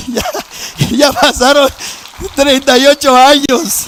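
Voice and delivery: preacher's loud slow repeat; emphatic repeat. Real, not acted. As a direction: drive the years home to the crowd. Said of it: "Hermano, ya pasaron treinta y ocho años".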